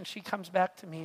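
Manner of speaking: ordinary talk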